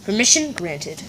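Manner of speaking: ordinary delivery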